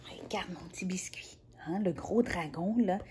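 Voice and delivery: soft whispered aside. Real, not acted. Reader whispers to her little biscuit.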